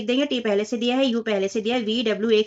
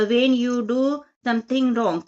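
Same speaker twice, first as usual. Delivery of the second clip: slow read-aloud; much slower than usual. Shown as a demonstration. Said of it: "reading exercise prompt aloud".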